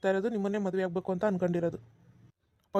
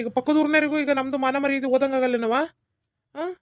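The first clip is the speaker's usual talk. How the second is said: scolding uncle; 6 semitones higher, 5 dB louder than usual. Acted. Uncle scolds niece Pavitra.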